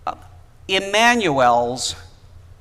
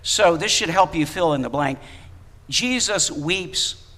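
ordinary talk, nothing marked

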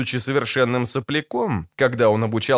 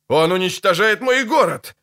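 higher, restrained wail; anguished howl; 6 semitones higher than usual. A narrator's reading. Mayor watching his town being destroyed, mildly howled dismay. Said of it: "взвыл Невилл".

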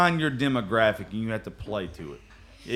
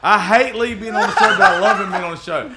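laughing; 6 semitones higher, 5 dB louder than usual. Real, not acted. Cracking up at Lee.

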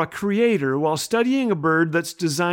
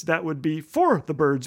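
usual speaking voice

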